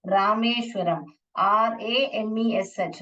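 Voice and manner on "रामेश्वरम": normal talking voice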